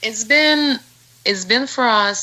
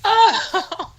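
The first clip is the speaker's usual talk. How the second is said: laughing; 13 semitones higher than usual. Real, not acted. Laughing at being teased.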